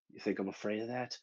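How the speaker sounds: soft, quick acted defiance; quiet defiance. Johnny's line, first low take.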